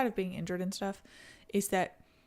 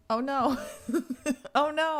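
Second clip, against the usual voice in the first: higher, louder alarmed exclamation; dismayed oh no; 6 semitones higher, 5 dB louder than usual. Real, not acted. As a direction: react in mock horror. Dismayed at Pampa F4 mention.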